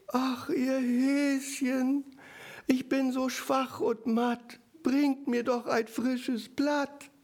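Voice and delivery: high, very slow whimper; whimpering fox. Retold or imitated. The fox pretends weakness to lure the bunnies, slow high whimper. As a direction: lure them in with a pitiful fake whine. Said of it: "hören ein Wimmern".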